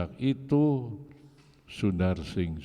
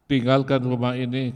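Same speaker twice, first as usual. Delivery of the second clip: louder, raised order to leave; angry order; 4 semitones higher, 6 dB louder than usual. Retold or imitated. Father drives his son out of the house with a loud order. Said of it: "Bapaknya bilang".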